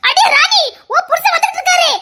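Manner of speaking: high excited heads-up; excited call. Acted. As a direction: call out the news, giggling. Calling out warning, laughing.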